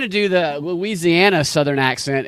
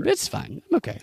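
high, strained, softer "I'm fine"; mock brave strain; 13 semitones higher, 7 dB quieter than usual. After the hot chip challenge, a strained high mock-brave "I'm fine". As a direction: pretend you're fine while your insides burn.

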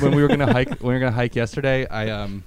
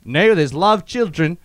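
high, louder, slow put-on accent; mock British dad; 7 semitones higher, 6 dB louder, slower than usual. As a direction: play the dad answering at the door. Imitating friend's British dad.

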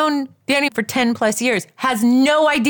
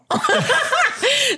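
high laugh. Real, not acted. Laughing at her own story.

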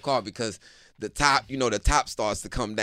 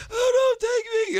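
much higher, frantic begging; panicked high plea; 5 dB louder, slower than usual. Retold or imitated. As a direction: squeal like a terrified mom. Mom panics at masked figure.

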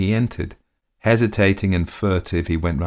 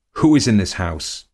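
demanding question, a bit louder; question flung at her; 3 semitones higher, 6 dB louder than usual. A narrator's reading. Man flinging a question at his mistress, demanding. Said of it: "He flung at her the question".

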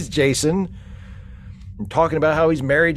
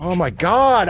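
ordinary delivery

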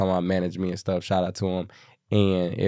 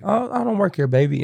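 put-on old lady voice; 10 semitones higher than usual. Old church lady voiced in story.

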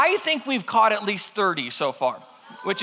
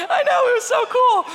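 high-pitched, louder burst of glee; gleeful; 5 dB louder than usual. Real, not acted. Storyteller relishing guts and blood everywhere, gleeful. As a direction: squeal it, delighted by the gore. Gleeful over the gory kill.

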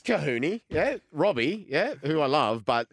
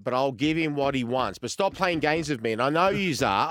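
mock angry boss; 5 semitones higher, 8 dB louder than usual. Manager losing patience over missing player.

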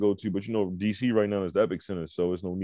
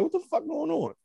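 baffled; 5 dB quieter than usual. Retold or imitated. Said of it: "I'm like".

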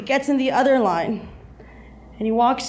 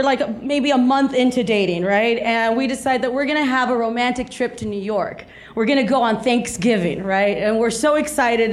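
ordinary talk, nothing marked

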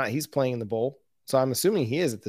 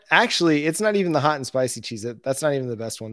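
ordinary talk

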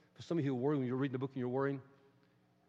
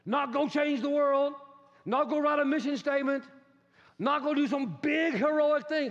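preaching at full force; 11 semitones higher, 8 dB louder than usual. Real, not acted. Preacher building to a climax.

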